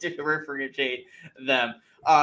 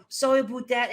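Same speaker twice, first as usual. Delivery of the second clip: high-pitched mock Canadian accent; overdone Canadian accent; 8 semitones higher than usual. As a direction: exaggerate the Canadian vowels mockingly. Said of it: "They don't have to go".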